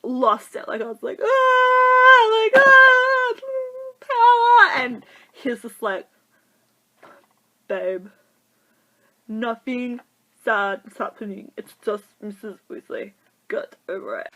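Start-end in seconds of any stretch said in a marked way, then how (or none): 1.2-4.8 long, high, loud squeal; overwhelmed fan screaming. Retold or imitated. Crying fan watching Missus Weasley, hammed-up screaming. Re-enacts losing it at the film. 7.7-14.3 deadpan, halting dismissal dropping softer; unmoved boyfriend. Retold or imitated. Boyfriend to crying girlfriend at Harry Potter, deadpan dismissal.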